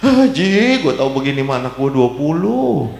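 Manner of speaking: hammed-up happy sobbing of an old woman; mock tearful joy. Grandmother overjoyed by envelopes to the preacher, mock crying. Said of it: "dia nangis".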